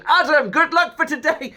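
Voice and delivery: put-on high, loud, laughing call of excited kids; cheerful kids calling out. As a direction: shout it out like a playground kid wishing luck. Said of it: "people running up to me in the playground going".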